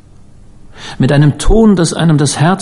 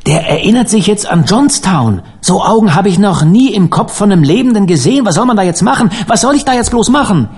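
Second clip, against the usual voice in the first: alarmed outburst climbing ever higher; agitated exclamation; 7 semitones higher than usual. A narrator's reading. Uncle Salters reacts to Penn's returning memory, rising agitation. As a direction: start emphatic, build to high-pitched helplessness. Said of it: "rief Onkel Salters".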